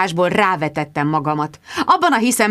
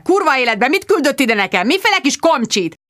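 raised, outraged complaint; bellowing; 7 semitones higher than usual. A narrator's reading. Sir Hugo complains to Finch about the reporter, bellowing. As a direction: rant indignantly down the phone, pitched up. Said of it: "bőgte".

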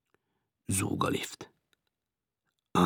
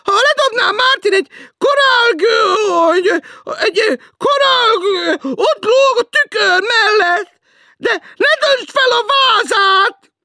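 much higher, loud and slow nagging; shrill teasing woman; 13 dB louder, slower than usual. Acted. Woman below teasing Martin, shrill and slow. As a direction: drag it out like a shrill landlady.

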